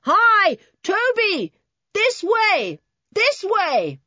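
high-pitched answering shout; calling back. A narrator's reading. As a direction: holler back to guide them over. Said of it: "a voice came back at once".

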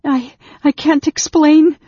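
high, halting distressed cry; broken, gasping plea. A narrator's reading. Anne Beryl gasping in his grip, high and broken. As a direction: stammer it out high and loud. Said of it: "whispered brokenly, gasping as Raistlin tightened".

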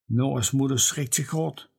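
plain reading voice

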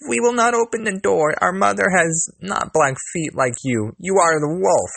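put-on high, loud little-kid voices; crying out. A narrator's reading. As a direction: shout the wolf away in a high kid voice. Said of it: "saw them and cried".

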